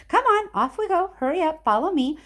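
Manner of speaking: high, bright rallying call; hurrying along. Acted. Mister Wonka hurrying the children into the corridors, high call.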